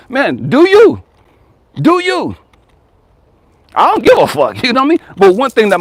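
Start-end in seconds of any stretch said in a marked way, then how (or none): 0.5-2.4 high, pointed do you, twice; challenging question. Real, not acted. Challenging listeners who judge his parenting, pitched up high. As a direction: throw the question back at them hard.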